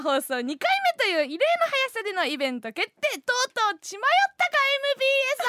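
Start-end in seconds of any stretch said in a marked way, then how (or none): 3.2-5.4 fast, much higher mock taunt; mock jab. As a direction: tease the station in a quick hammed-up jab.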